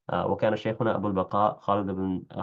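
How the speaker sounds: usual speaking voice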